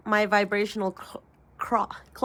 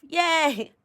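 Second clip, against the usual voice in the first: cheer; 8 semitones higher, 3 dB louder than usual. Real, not acted. Cheering after the affirmation.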